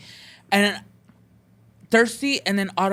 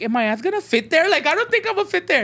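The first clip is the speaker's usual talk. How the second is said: high-pitched, incredulous worry; alarmed doubt; 9 semitones higher than usual. Retold or imitated. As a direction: voice climbing high in retold alarm. Retells alarm at tiny bed.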